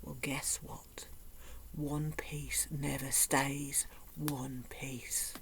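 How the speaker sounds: slow hushed knowing whisper; wry confiding whisper. Real, not acted. Telling the audience the truth about chocolate, slow hush. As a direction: let them in on the secret quietly. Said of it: "wry confiding aside".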